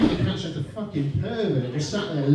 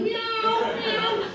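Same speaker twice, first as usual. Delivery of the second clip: high mock meow, much higher; cat meowing. Cat still meowing at the bowl, high mock meow. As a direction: whine it up high like a hungry cat.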